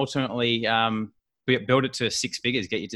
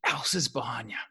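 imitated urgent whisper; 8 dB quieter than usual. Wife secretly telling husband a celebrity is behind him, whispered. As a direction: hiss it so nobody hears.

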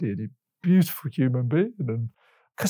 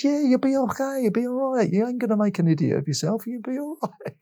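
much higher, put-on voice for the woman; high voice quoting her encouragement; imitating her reassurance; 11 semitones higher than usual. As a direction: mimic her soothing you in a lifted voice. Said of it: "So she said".

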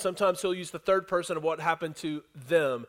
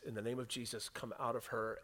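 low, very soft command; hushed command; 7 semitones lower, 15 dB quieter than usual. Acted. Paul, annoyed, to the spirit, hushed command. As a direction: give the order low and quiet. Paul, fed up, retold very softly.

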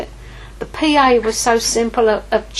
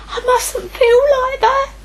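squeaky, much higher mock self-scolding; mock high squeal; 4 dB louder than usual. Preacher mocking her own inner thought, squeaky high. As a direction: squeal it in a put-on high voice, half crying.

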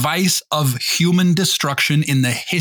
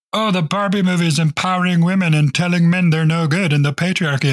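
higher, run-on mocking voice; mock outrage; 5 semitones higher, 3 dB louder than usual. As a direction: parody an overheated pundit. Mimics political chatter about Barbie.

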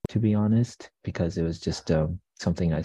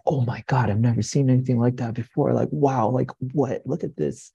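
high-pitched, rushed amazement; freaking out; 7 semitones higher than usual. Retold or imitated. Barista retelling a customer's reaction to latte art, freaking out. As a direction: imitate an astonished customer, pitched up and quick. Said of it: "like, freaking out".

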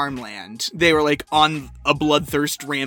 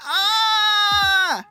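high shrieking ah; high mock squeal, like a smitten schoolgirl; mock girlish squeal. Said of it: "You went".

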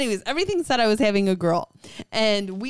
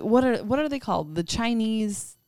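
ordinary talk

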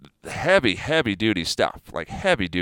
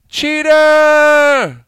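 much higher, far louder, drawn-out yell; cheater shout; 11 dB louder than usual. Real, not acted. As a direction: call them out with a long accusing shout. Denouncing steroid users.

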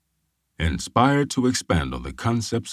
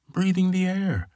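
Ray Bradbury voice. Retold or imitated. Ray answers the police officer.

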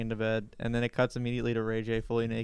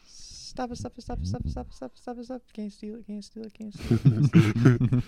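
high squeaky pleading voice; mock shy denial; 8 semitones higher, 3 dB louder than usual. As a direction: squeal it like a flustered kid. Squealing protest at the song.

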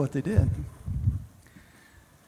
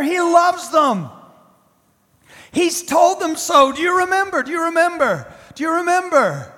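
rising fervor; 6 semitones higher, 4 dB louder than usual. Acted. Preacher voicing the prophets' certainty, rising and fervent. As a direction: swell with conviction.

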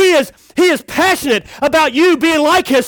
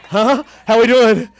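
usual speaking voice

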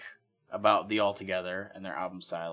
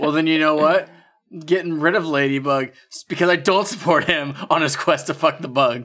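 loud retort; 5 semitones higher, 8 dB louder than usual. Real, not acted. Mock retaliation, loud.

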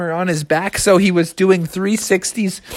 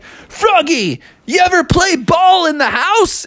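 Captain Crunch; 11 semitones higher than usual. Acted. Captain tempting the boy to play ball, mischievous. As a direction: egg the kid on playfully. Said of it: "And then Captain was like".

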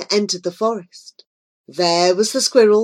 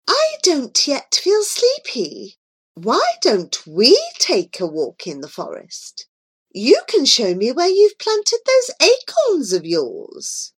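queen; 6 semitones higher than usual. A narrator's reading. Queen speaking to Joy.

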